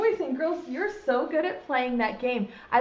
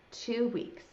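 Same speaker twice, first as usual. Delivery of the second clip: subdued echo; 8 dB quieter than usual. Acted. Caterpillar takes in how long it takes, subdued. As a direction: mumble the news back to yourself.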